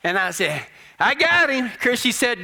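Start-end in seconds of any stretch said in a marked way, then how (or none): 1.0-1.7 higher-pitched announcement of a catch; triumphant call. Retold or imitated. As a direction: call it out proudly to the wife. Acted triumphant call to wife.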